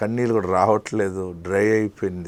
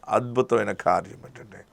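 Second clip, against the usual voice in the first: normal talking voice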